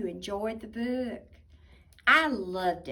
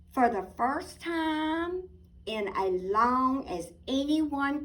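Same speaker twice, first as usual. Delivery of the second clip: put-on lively storybook narration for kids; reading to children; 4 semitones higher, 8 dB louder than usual. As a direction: tell it like a bedtime picture book. Reading picture book aloud.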